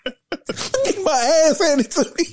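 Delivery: high-pitched comic plea through laughter; mock yelling. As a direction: wail for backup while cracking up. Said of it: "My ass would have been yelling".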